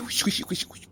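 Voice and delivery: hushed whisper of a secret. Acted. One man whispering a hush to his scheming friend.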